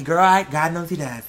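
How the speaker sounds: ordinary talk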